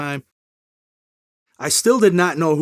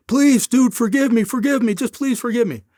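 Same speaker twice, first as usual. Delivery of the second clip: pleading friend; 8 semitones higher, 3 dB louder than usual. Retold or imitated. Friend begging for forgiveness.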